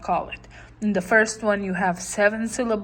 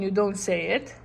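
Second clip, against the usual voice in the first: normal talking voice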